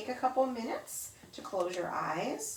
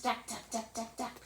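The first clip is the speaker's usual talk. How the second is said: softer, quick rattling duck duck like a jackhammer; jackhammer imitation; 3 semitones higher, 8 dB quieter than usual. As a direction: rattle it like a jackhammer far away. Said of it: "loud banging sounds".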